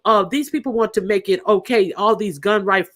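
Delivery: normal talking voice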